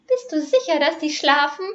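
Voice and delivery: a very high, thin male voice; extreme high man's voice. A narrator's reading. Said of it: "wisperte eine extrem hohe Männerstimme". (hohe Männerstimme, etwas lauter)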